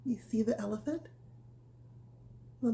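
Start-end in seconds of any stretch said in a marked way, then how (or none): none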